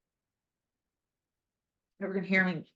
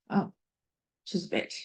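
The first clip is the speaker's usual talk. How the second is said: a little choked up, real emotion; tearing up; 6 dB quieter than usual. Real, not acted. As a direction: zachtjes ontroerd. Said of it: "I am tearing up".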